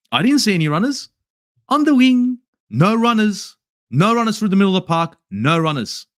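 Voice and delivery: ordinary talk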